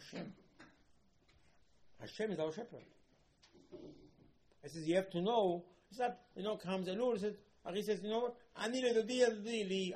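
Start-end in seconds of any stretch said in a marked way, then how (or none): 4.9-9.9 high, loud, fervent exclamation; fervent quote. Acted. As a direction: proclaim it with burning joy. Preacher quotes Ari with fervour.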